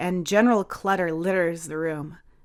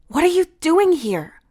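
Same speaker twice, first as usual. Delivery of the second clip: high, loud surprised question; startled question; 12 semitones higher, 6 dB louder than usual. Acted. Rain surprised to find Heath in her kitchen, high startled question. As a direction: still jumpy, demand why they're here.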